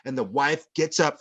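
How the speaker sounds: ordinary talk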